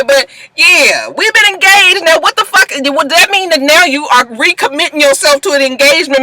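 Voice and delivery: normal talking voice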